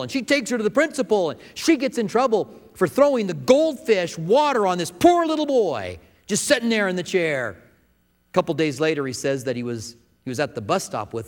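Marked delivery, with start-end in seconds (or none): 0.0-7.6 higher and louder, building excitement; excited storytelling. A narrator's reading. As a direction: tell the climax with rising energy.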